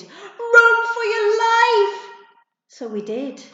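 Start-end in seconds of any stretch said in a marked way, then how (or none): 0.0-2.1 very high, loud, slow alarm shout; urgent shout. Acted. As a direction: shout for everyone to flee. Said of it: "Jody shouted".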